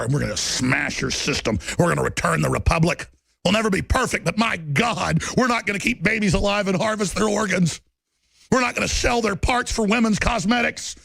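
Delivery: ordinary talk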